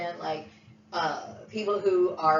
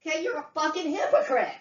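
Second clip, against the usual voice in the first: high-pitched anger; 9 semitones higher than usual. Real, not acted. Furious rant at relatives.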